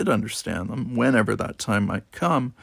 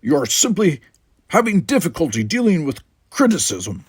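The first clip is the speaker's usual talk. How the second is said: louder, higher lofty retort; Worf's retort; 7 semitones higher, 6 dB louder than usual. Acted. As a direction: dismiss her complaint. Worf retorts.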